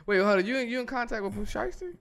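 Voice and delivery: high-pitched shocked question; surprised. Real, not acted. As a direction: pitch jumps up in disbelief. Shocked at the claim.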